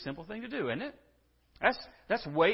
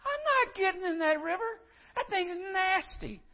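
high disgusted whine. Retold or imitated. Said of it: "Naaman's reply".

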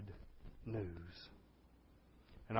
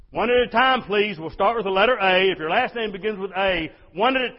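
high, loud crowd-control call; gatekeeper keeping order; 7 semitones higher, 12 dB louder than usual. Acted. Gate officer to a starving city, shouted orders. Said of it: "You're in charge of keeping order".

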